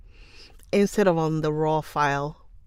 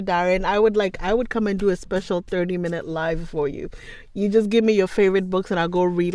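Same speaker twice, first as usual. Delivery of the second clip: ordinary talk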